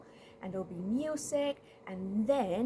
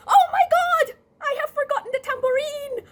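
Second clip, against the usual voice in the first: very high, loud wail; hammed-up horrified shriek of remembering; feigned panic; 14 dB louder than usual. Rat interrupting the church service, shrieking in mock panic. Rat cries out, faking forgetfulness.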